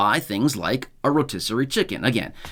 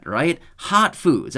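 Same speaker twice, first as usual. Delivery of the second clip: ordinary talk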